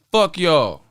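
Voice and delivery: ordinary talk, nothing marked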